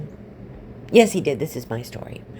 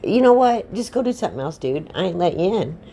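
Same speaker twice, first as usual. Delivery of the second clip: higher, piping pig retort; cartoon pig talking back; defiant third pig; 4 semitones higher than usual. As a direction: pitch it up and tell the wolf off. Third pig refuses the wolf.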